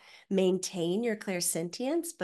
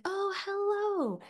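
sweet customer rep; 7 semitones higher than usual. Retold or imitated. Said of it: "And they're like".

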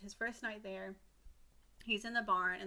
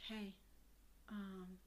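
hushed threat; 14 dB quieter than usual. Retold or imitated. Driver scolding donkey.

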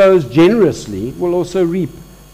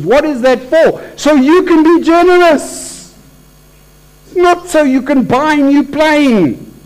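preaching crescendo; 7 semitones higher, 3 dB louder than usual. Real, not acted. Preacher driving home the point to congregation, loud and high. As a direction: preach it out, lifting the room.

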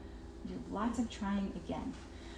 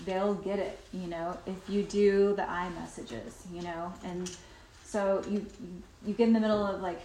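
ordinary talk

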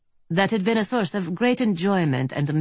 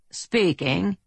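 drawl; 4 semitones higher than usual. A narrator's reading. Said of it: "Earp drawled".

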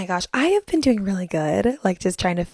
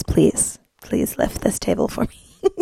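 breathy whispered low plea; mock sweet plea; 4 semitones lower, slower than usual. To the helpful wedding men, a teasing whispered plea. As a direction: coo it like a cheeky favour. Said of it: "And I'm like".

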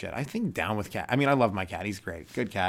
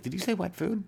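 higher hopeful cat voice; 10 semitones higher than usual. Retold or imitated. Cat perking up at wet food, higher. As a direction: perk up as the cat. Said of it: "He's like".

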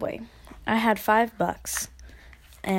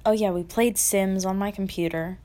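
ordinary delivery

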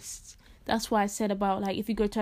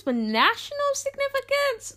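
mocking disbelief. Real, not acted.

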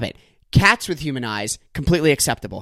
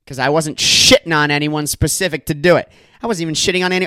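ordinary talk

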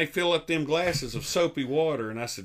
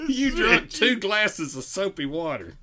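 usual speaking voice

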